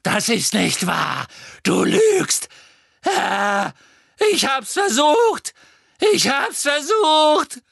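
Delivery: high, loud, panicked wail; desperate screaming. A narrator's reading. Witch begs her master not to punish her, panicked screaming. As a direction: build from denial into drawn-out pleading screams. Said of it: "schrie sie".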